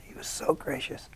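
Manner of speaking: "He was so gracious." repeated in a soft, breathy whisper; hushed echo. Real, not acted. Actor fondly recalling the gracious director, whispered echo.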